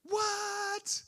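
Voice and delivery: squeaky high astonished what; mock baffled what. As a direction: squeal it in hammed-up disbelief. Women's baffled reaction.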